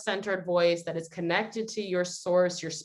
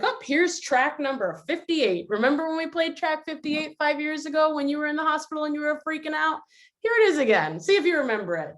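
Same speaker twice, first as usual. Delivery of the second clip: put-on high, chatty voice announcing an old track; record player character; 8 semitones higher than usual. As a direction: play a pitched-up presenter cueing up a memory. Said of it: "kind of like a, a record player being like".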